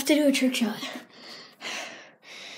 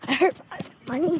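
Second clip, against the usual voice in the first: normal talking voice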